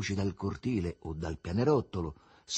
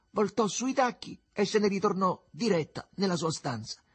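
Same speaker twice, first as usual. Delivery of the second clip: ordinary delivery, nothing marked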